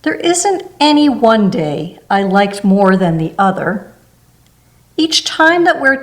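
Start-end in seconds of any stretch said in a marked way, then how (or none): none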